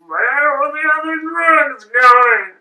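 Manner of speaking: very high, slow, drawn-out question; silly audition voice. Acted. Trying out voices for Batman, high silly voice. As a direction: stretch it high in one long arc.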